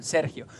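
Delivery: "Sergio" said SEHR-hyo, low and matter-of-fact; Spanish pronunciation. Shown as a demonstration. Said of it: "como lo digo en español".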